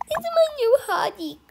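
high, loud gloat; bully bragging loudly. Acted. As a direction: crow over the victim.

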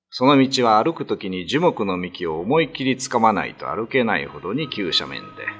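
usual speaking voice

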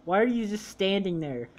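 usual speaking voice

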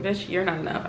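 sarcastic inner voice. Retold or imitated. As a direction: mutter the put-down to yourself. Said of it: "That's one that I was like".

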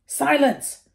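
high, loud, sharp snap; snapped command. Acted. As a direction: bark him into silence. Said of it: "snaps Snape again".

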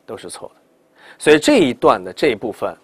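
normal talking voice

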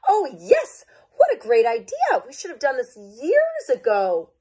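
high, loud overjoyed cheer; excited states. Acted. The states cheering the swap idea, high and thrilled. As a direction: gush with excitement. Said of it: "a wave of excitement".

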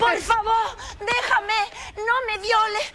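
high-pitched, drawn-out begging; panicked pleading. Acted. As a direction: plead desperately with an intruder.

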